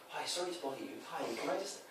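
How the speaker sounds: meek, high-toned politeness; supplicating. Shown as a demonstration. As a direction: ask softly as if bothering someone. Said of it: "raised voice tonality".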